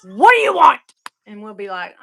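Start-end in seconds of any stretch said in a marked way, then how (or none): 0.0-0.8 higher, louder annoyed snap; irritated yell. Retold or imitated. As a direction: snap at them to shut up. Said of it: "he'll go".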